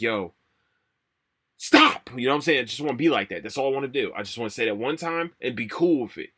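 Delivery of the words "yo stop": shouted stop. Pretend. Annoyed at keyboard tapping, yelling stop. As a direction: snap out a loud stop at the typist.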